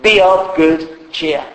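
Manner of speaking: "Be of good cheer" repeated slowly and firmly; slow echo. Shown as a demonstration. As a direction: press it home slowly. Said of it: "King James puts it like this".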